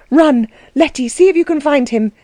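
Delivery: high, hurried-sounding plea; urgent. A narrator's reading. Anna to Letty to find the coachman, urgent. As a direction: send her off in agitation.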